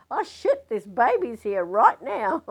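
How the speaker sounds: high, loud, startled exclamation; shocked outburst. Retold or imitated. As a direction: imitate a nurse's sudden alarm. Nurse suddenly finds baby arriving.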